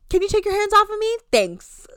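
high, loud, fast snap; imitating an angry woman pushing a man away; pissed off. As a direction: bite it off, fed up and done. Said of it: "Like, pissed".

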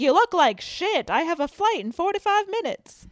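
loud, high mock bossy man; domineering man. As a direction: bark it like a pushy director. Acting out the domineering director.

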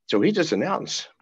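ordinary talk

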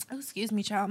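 coughing through the words; speaking while choking. Real, not acted. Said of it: "I'm choking".

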